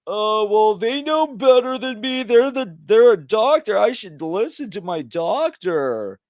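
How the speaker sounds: high, mock-naive voice; mocking a naive patient who trusts the doctor; mocking gullible patient. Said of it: "It's like".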